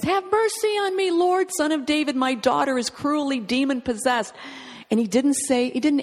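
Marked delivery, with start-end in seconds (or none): 0.0-4.3 crying out. Acted. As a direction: cry out for mercy as a desperate mother. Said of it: "Syrophoenician woman cries out and says".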